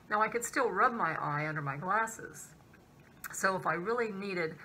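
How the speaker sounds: speaking behind clear plastic; through a plastic mask. Real, not acted. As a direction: mouth covered by mask. Seen 1: Clear plastic mask visibly covers nose and mouth throughout; absent in R frames.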